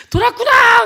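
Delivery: high, loud scornful shout; angry yell. Retold or imitated. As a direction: snap it out in disbelief. Relatives shouting back. (돌았다고 소리치는 친척들)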